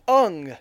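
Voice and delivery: "Ung" with a rounded vowel, like "Ong"; mispronounced name. Shown as a demonstration. Host mocking the film's name for Aang, stressed "Ung". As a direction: lean on "Ung" in place of Aang. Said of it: "mispronounce basically everybody important's name".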